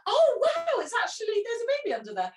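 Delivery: delighted surprise. Retold or imitated. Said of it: "they're like".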